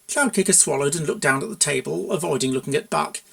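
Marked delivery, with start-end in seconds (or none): none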